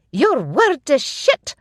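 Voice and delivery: high, loud, slow furious yell; angry shout. A narrator's reading. Ina insulting Magnus before the warriors, furious shout. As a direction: spit it out at the laird. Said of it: "Ina shouted".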